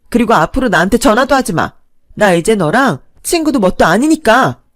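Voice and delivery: high, loud furious yell; screaming friend. Acted. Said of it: "민정이가 빽 소리를 질렀어요".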